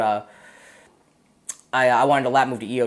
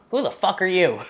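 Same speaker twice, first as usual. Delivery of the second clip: retold drill sergeant; 6 semitones higher than usual. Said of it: "and he's like".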